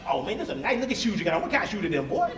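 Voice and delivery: put-on soft quick old country voice; country dad. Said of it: "He's like".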